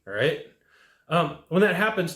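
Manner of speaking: ordinary talk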